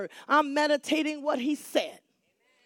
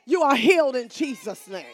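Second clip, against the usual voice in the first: ordinary talk, nothing marked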